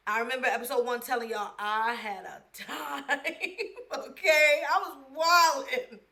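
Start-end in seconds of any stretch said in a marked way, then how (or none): 3.1-6.1 laughing through okay; real laugh. Real, not acted. Recalling wild freshman year, laughing. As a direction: caught in a giggle.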